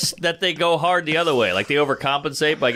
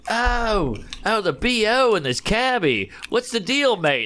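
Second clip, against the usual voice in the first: a higher, hammed-up Aussie voice; Australian twang, half-mocking, pitched up; mock Australian accent; 5 semitones higher, slower than usual. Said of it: "and he's going".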